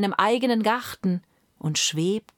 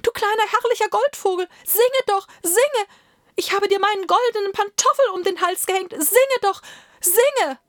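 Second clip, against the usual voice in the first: high, loud, fast begging; frantic emperor commanding and pleading at once; desperate screaming plea; 14 semitones higher, 5 dB louder than usual. A narrator's reading. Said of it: "schrie der Kaiser".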